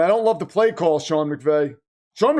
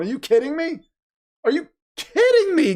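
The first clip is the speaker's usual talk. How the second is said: stunned disbelief; 8 semitones higher than usual. Real, not acted. Stunned by an incredible pass.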